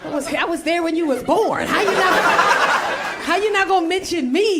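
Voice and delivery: offended sibling demanding an answer; mad. Retold or imitated. Twin scolding sister for forgetting her, acted outrage. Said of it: "Why are you mad?".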